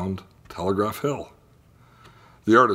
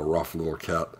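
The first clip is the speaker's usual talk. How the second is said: plain reading voice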